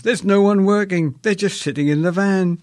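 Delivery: high, loud triumphant crowing. Retold or imitated. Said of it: "I crowed".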